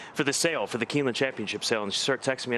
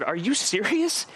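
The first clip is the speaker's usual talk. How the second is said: disbelieving; 7 semitones higher, slower than usual. Retold or imitated. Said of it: "I said".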